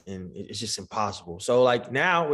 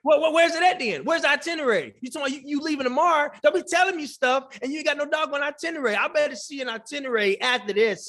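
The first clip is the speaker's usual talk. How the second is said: high, rapid-fire indignant demands; mock scolding; 8 semitones higher, faster than usual. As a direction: scold him playfully for having no proof. Mock grilling about the itinerary.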